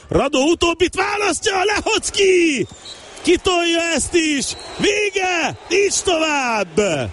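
high shouted names, then a slow finish; excited final whistle call. Real, not acted. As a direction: roar out the end of the match.

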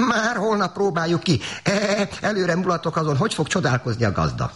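very high bleating goat voice; high-pitched, impatient goat; eagerly urging goat. A narrator's reading. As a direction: stretch the opening words like an excited little goat. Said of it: "A kecske ugrándozva sürgette".

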